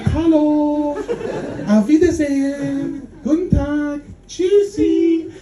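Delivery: high, loud singsong German greetings; singsong Berlin German greetings; chirpy Berliner. Retold or imitated. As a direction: trill the greetings like a cheery Berliner, ending almost sung. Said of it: "everybody's like".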